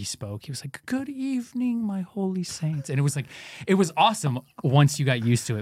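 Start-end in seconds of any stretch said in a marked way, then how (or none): 0.8-2.9 high, slow storybook greeting; fairy-tale reading voice. Retold or imitated. Host imitating his beloved Monsignor greeting mass, storybook voice. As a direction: read it like a fairy tale to children. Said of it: "sounded like he was reading a fairy tale".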